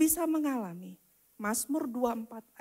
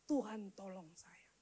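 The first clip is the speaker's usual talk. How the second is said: hushed, whispered plea; a cry for help shrunk to a whisper; retold cry to God; 4 semitones lower, 13 dB quieter than usual. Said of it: "saya menjerit kepada Tuhan". (jeritan doa yang dibisikkan)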